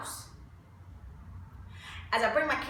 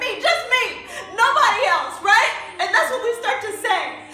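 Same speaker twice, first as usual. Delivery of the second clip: builds louder and higher to a near shout; impassioned rising rant; 5 semitones higher, 6 dB louder than usual. Acted. Mother listing her solo chores, rising to an emotional outcry. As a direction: pour out every burden you carry alone, climbing.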